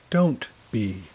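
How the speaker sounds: understated, cool reply; cold. A narrator's reading. Dagny answering her friend's apology, mildly cold. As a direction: cut off sympathy with a touch of coldness. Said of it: "Dagny says coldly".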